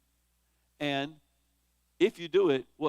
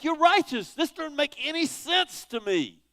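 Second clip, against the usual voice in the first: baffled protest; 11 semitones higher, 6 dB louder than usual. Retold or imitated. Voicing prophet's bafflement at God.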